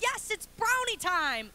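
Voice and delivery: put-on high, slow, emphatic toddler glee; insistent toddler. Toddler answering doubtful mom, high excited insistence. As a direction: insist like an excited three-year-old.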